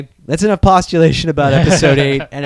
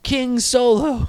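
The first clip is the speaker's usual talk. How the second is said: mock reverent; 10 semitones higher, much slower than usual.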